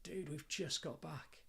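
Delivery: slow, soft, exasperated dad to his son; quiet, fed-up "dude"; weary quiet retort. Retold or imitated. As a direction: drag it out, tired of it. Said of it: "and I'm like".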